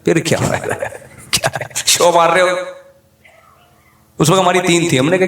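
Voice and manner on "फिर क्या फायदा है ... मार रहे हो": teasing question through a chuckle; amused retold jibe; laughing retort. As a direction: laugh it out while mocking. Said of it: "मैंने कहा".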